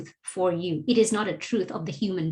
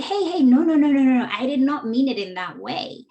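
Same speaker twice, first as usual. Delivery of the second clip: louder, higher hurried denial; flustered protest; 4 semitones higher, 5 dB louder than usual. Retold or imitated. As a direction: rush to correct the misunderstanding. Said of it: "And you go like".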